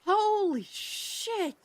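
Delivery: high, drawn-out gasp of disbelief; a slow, high stunned holy shit; shocked exclamation. Real, not acted.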